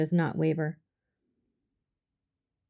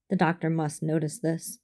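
plain reading voice

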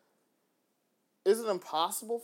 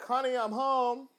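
higher and slower, sing-song greeting; cheery husband coming home; 6 semitones higher, 3 dB louder than usual. Retold or imitated. Husband back from work calls out a singsong greeting. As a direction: call it out like walking in the door. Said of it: "that kind of thing".

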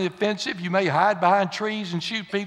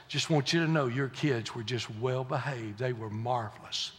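hushed aside; 8 semitones lower, 11 dB quieter than usual. Retold or imitated. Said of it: "somebody says".

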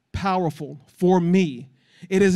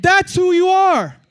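high, raised preaching voice; emphatic proclamation; 11 semitones higher, 6 dB louder, slower than usual. Real, not acted. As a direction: drive it home to the congregation. Preacher proclaims after call and response.

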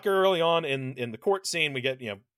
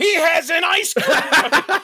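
incredulous shout; 11 semitones higher, 9 dB louder, slower than usual. Real, not acted. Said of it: "one of my notes in all bold".

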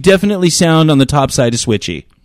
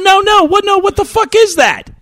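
shocked disbelief. Real, not acted. Startled by Shitter's Full mention.

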